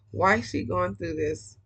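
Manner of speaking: ordinary talk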